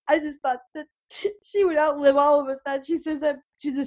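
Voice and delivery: laughing and crying. Retold or imitated. Daughter at her grandmother's graveside, imitated laughing and crying. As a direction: mimic someone laughing through tears. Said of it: "She's laughing and crying at the same time".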